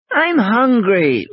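high, drawn-out whine; plaintive little-kid complaint; complaining child. Acted. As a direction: whine it slowly like a hungry child.

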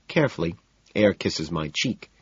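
ordinary delivery

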